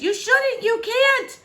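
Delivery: high, loud frantic warning; panicked warning. A narrator's reading. Princess warning the climbing king, frantic. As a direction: plead urgently in alarm.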